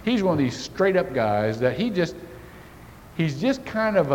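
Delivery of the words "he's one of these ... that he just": ordinary delivery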